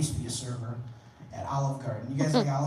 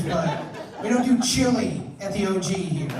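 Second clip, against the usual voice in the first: usual speaking voice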